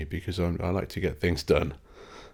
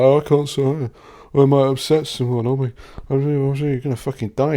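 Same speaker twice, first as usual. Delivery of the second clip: higher, louder whiny mockery; mocking fake arty types; 6 semitones higher, 5 dB louder than usual. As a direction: sneer at precious oversensitive artists. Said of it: "They're like".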